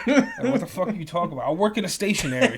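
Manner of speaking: loud, high, laughing outburst; laughing, indignant outburst; laughing disbelief. Real, not acted. Incredulous rant about the errand.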